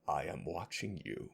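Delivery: low, hushed mock warning; quiet, low I've-got-my-eye-on-you; mock menace. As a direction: drop right down into a playful threat. Playful warning after scolding.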